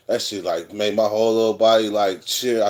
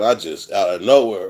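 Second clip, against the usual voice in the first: ordinary delivery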